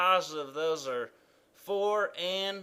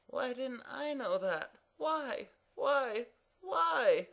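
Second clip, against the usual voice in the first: rising, higher whines of why; whiny lament; 9 semitones higher, 3 dB quieter, slower than usual. Acted. Evan wails why he didn't know the rule, whining. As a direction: wail in frustration. Character laments not knowing.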